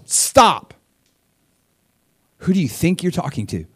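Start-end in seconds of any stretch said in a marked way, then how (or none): 0.0-0.7 loud high commanding stop; God's stern stop. Acted. God silencing sinners at judgment, loud command. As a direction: halt them cold. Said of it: "it's just gonna be like".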